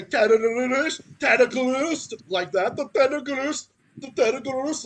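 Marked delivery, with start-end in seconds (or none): 0.0-2.1 louder, hammed-up Israeli accent; mock an Israeli accent with a mangled R in "tentacles". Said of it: "imitate the Israeli accent". 2.8-4.8 louder, hammed-up Israeli accent; mock an Israeli accent with a mangled R in "tentacles". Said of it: "They say".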